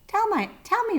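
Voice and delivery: sharply high nosy question; high boar voice. A narrator's reading. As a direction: boar asks rhinoceros, pitched high.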